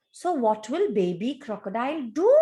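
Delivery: ordinary delivery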